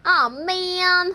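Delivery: slow Brooklyn game voice line; drawn-out Brooklyn "oh man"; Brooklyn accent. Acted. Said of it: "I was trying to do my Brooklyn accent".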